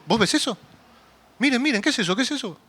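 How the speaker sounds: a disciple squinting into the dark, unsure; startled disbelief. Retold or imitated. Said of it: "el primero que lo vio dijo". (asombro inquieto, más agudo)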